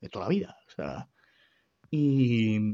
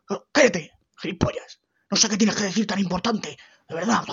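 mother scolding; 7 semitones higher, 4 dB louder than usual. Retold or imitated.

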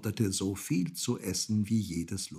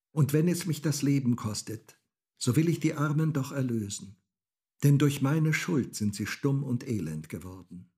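put-on determined girl; 6 semitones higher, 4 dB louder than usual. A narrator's reading. Girl vows to free the sheep.

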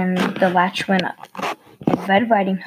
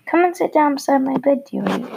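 fast, high wolf pretending to be Granny, lightly wheezed; a wolf faking a sweet old voice, hint of a wheeze; wheezing wolf; 6 semitones higher, faster than usual. A narrator's reading. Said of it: "wheezed the wolf".

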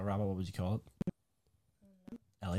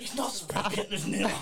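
usual speaking voice

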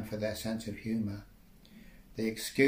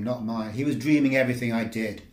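usual speaking voice

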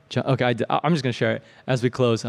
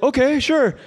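nervous agreement; 11 semitones higher, 4 dB louder than usual. Retold or imitated. Nervous child voiced.

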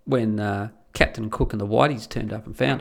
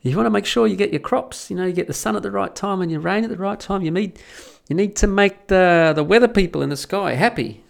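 higher, slick con man's pitch; smooth grifter; 6 semitones higher than usual. Retold or imitated. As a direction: sell it like a smooth con man. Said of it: "Grifter turns up and goes".

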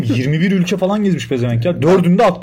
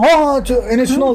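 higher-pitched imitation of delighted recognition; mock excited recognition; 6 semitones higher than usual. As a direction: act out suddenly recognising the name. İspanyolların tanıma anını canlandırıyor. (birden tanımış gibi coşkulu taklit)

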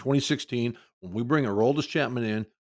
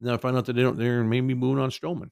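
ordinary talk, nothing marked

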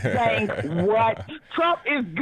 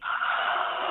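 shocked gasp; 5 dB quieter than usual. Real, not acted. Caller shocked by the answer.

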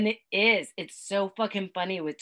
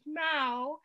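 higher, rising little meow; toddler meow; 9 semitones higher than usual. Retold or imitated. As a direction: coo a baby meow. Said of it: "So he goes".

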